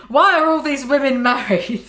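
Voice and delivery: bicara sambil tertawa geli; tertawa saat membaca. Real, not acted. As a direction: tahan tawa sambil membaca. Reader laughs while reading quote.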